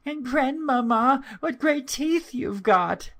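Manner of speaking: put-on a small girl starting to fear; frightened little girl. Girl noticing the wolf's teeth, high child voice. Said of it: "who now began to be rather afraid".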